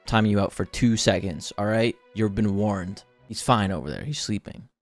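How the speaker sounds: ordinary talk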